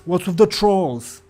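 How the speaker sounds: a second voice in the crowd calls out; different bystander voice. Acted.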